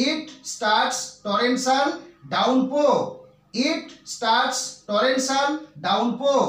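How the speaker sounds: word by word, dragged out for copying; slow teacher dictation. Shown as a demonstration. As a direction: read it slowly for the class to write. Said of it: "আবার বলতে পারি".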